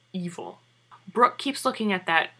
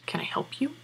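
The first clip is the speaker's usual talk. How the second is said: hushed, menacing question, mildly acted; a quiet threat to someone snooping in his room; pissed off; 7 semitones higher, 5 dB quieter than usual. Father catching her in his room, a hint of quiet menace. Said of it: "he is pissed".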